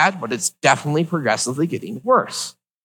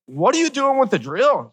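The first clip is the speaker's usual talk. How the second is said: alarmed; 9 semitones higher than usual. Retold or imitated. Nurse brings out a drill.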